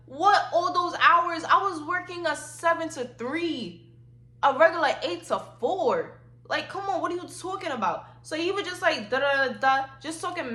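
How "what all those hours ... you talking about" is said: indignant and high-pitched; incredulous retort. Retold or imitated. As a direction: push back, stretching the words out in indignation. Incredulous retort to dad's remark.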